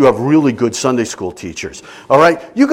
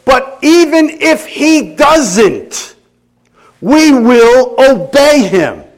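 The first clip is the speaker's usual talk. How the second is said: slow, high, louder vow of faith; defiant declaration; 9 semitones higher, 6 dB louder, much slower than usual. Acted. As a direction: lean in on every word, unshakable. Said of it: "What they say was".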